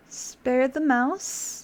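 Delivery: each word stretched out slowly; slow, teasing, deliberate repeat; very slow insistence. Real, not acted. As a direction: drag it out stubbornly. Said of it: "insisting on the choice".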